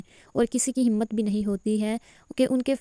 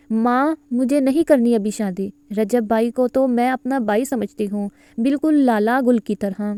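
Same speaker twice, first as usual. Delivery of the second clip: usual speaking voice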